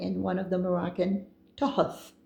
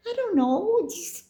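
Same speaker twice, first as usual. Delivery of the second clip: a much higher, squeaky I don't know; helpless squeak; 11 semitones higher than usual. Real, not acted. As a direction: shrug it off in a high squeak. Squeaky helpless shrug recalling the snake.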